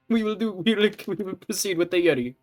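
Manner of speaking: hammed-up fake Russian accent, loud at first; exaggerated Russian accent, comic; bad Russian accent. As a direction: play a cartoon Russian seductress. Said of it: "a really shitty Russian accent".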